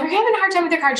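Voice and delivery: high, sweet voice to a stranger in the checkout line; sweet helpful stranger. Retold or imitated. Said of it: "I'm like".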